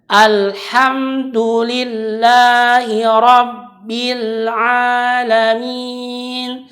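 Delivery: slow melodic chant; Quran recitation. Shown as a demonstration. Recites the second verse. (tilawah pelan dan berlagu)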